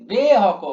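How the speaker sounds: "বে" loud and stretched; drawn-out syllable. Shown as a demonstration. Teacher marking the long beat, "বে" long-drawn. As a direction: hold "বে" long before "Haque". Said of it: "টান পড়ছে".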